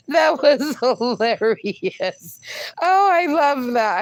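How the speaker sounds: words squeezed out between laughs; laughing. Real, not acted. Host reacting to the chat joke, laughing. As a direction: still laughing, drawn out slowly.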